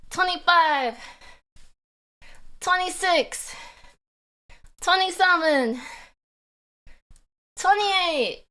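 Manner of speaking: counting squats under strain; strained counting during squats. Real, not acted. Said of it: "day three of squats".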